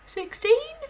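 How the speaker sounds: put-on high, loud cheerful child; little boy voice. Small boy Rupert answers the headmistress, high and careless.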